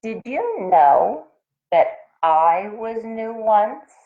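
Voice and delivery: a hint of a spluttering bird. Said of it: "spluttered Toast".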